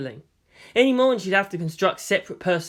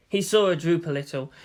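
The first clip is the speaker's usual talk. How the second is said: ordinary delivery, nothing marked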